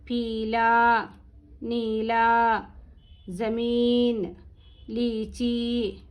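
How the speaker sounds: very slow, drawn-out vowels on an even pitch; slow drill recitation. Shown as a demonstration. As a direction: chant each word separately, pausing between. Teacher reads the word list through.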